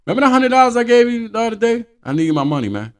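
loud, fast, high demanding voice; demanding payback. Retold or imitated. Lender coming back for his hundred, loud and high. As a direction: suddenly demand the money back. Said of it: "I come to you".

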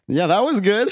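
high, forced-upbeat reply; retold put-on cheer. Worker answering boss about the game, put-on high cheer. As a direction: pitch it up in mock enthusiasm. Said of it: "and I'm like".

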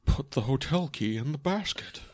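creepy movie-villain impression, drawn out; Buffalo Bill. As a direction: imitate a sinister film killer giving orders. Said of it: "he sounded like Buffalo Bill".

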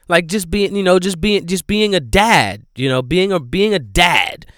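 loud, insistent retelling; exasperated emphasis. Real, not acted. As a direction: hammer home how dad-like he is. Mocking the watchful dad.

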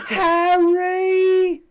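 very loud, high, drawn-out yell; Ron Weasley yell. Retold or imitated. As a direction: scream the name in imitation. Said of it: "Ron Weasley distinctly yelled".

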